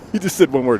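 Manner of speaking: normal talking voice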